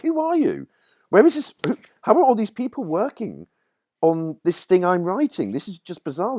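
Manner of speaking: very high, incredulous, baffled; bewildered. Retold or imitated. New writer baffled by strangers in the meeting, high pitched. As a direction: sound completely bewildered. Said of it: "And you're like".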